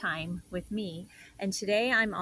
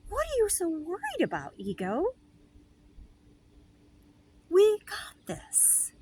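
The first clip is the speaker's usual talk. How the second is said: much higher, slow, airy and otherworldly; soothing spirit voice; 11 semitones higher, slower than usual. Retold or imitated. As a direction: coo it like a serene guardian angel. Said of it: "my spirit, again, goes".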